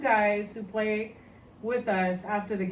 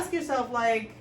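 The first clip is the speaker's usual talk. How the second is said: ordinary talk, nothing marked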